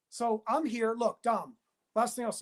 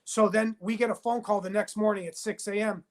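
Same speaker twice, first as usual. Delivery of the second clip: normal talking voice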